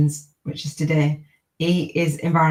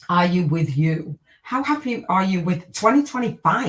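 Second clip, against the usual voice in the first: usual speaking voice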